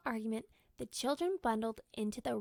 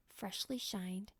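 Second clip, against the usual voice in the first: ordinary delivery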